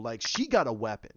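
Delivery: usual speaking voice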